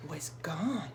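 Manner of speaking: ordinary delivery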